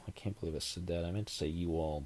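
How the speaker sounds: normal talking voice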